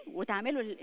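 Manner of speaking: ordinary talk, nothing marked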